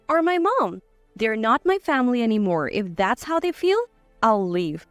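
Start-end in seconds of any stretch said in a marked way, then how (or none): none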